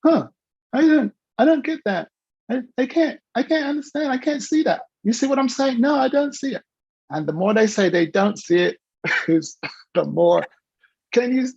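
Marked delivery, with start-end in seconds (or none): 0.0-6.6 put-on high, bewildered child voice; confused child. Speaker mimics a confused child who can't see choices, high. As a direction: play a baffled little kid. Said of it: "causes the child to go".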